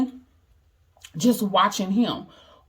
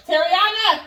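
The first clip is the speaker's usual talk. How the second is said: much higher, louder call across the house; 10 semitones higher, 3 dB louder than usual. Real, not acted. Mother calling to her daughter for ice cream, loud high call. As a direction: call her name from the other room.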